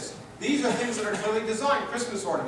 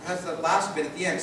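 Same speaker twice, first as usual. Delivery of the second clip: ordinary delivery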